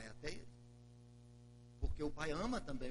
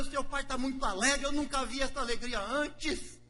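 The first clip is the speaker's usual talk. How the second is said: higher and louder, full of wonder; excited servant; 10 semitones higher, 5 dB louder than usual. Acted. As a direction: tell the joyful news breathlessly. Knecht vertelt over feest.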